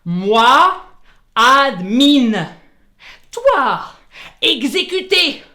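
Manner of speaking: loud, halting robot speak; robot caveman talk. Acted. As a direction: bark it like a robot. Talks robot-style to the machine. (voix de robot saccadée)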